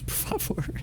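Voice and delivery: breathy aside, no voice; whispered aside. Real, not acted. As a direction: drop to a whisper for the jab. Zomba do pastor, à parte. (sussurrado, em tom de deboche)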